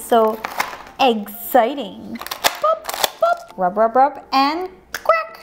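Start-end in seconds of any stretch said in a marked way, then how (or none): 2.2-3.6 tiny high breathy "boop, boop"; high playful boop. Pretend. Booping the toy egg, high squeak.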